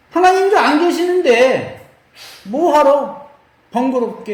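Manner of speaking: high-pitched, slow dismissive huff; acted sulking. Priests who think God has left, mock sulky huff. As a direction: play someone peeved who can't be bothered. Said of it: "삐진 거예요".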